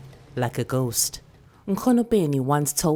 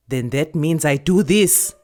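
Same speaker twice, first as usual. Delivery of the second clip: louder, matter-of-fact elder; firm grandmother; 7 dB louder than usual. Acted. Grandmother telling the girl she will comb her hair. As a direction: settle it firmly.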